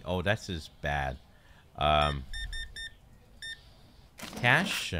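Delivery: usual speaking voice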